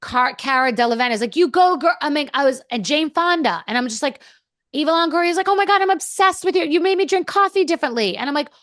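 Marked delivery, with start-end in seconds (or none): 1.4-2.0 higher, louder mock cheer. Star cheering her on in the lineup, retold cheer. 5.5-8.2 high-pitched retold gush. Star praising her backstage in Paris, imitated gushing.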